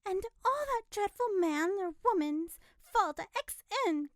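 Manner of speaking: loud, high furious cry; angry telegraph girl; savage outburst. A narrator's reading. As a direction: lash out at the absent culprit. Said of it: "cried Natty savagely".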